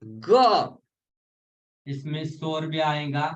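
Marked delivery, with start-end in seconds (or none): 0.0-0.8 ग pushed out loud from the belly; hard ग from the navel. Shown as a demonstration. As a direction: force out a louder ग. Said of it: "देखो". (ग को नाभि से ज़ोर लगाकर बोलें)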